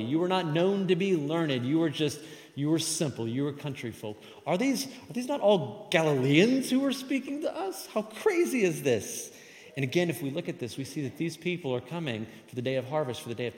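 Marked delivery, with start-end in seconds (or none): 4.5-9.3 high-pitched, astonished disbelief; incredulous. Acted. Crowd stunned that country folk speak to them, incredulous. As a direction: question it in amazement, slowing at the end.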